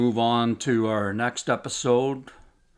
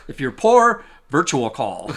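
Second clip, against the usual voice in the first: excited; 10 semitones higher, 7 dB louder than usual. Real, not acted. Mock answer with big laugh.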